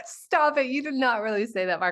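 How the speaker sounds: ordinary delivery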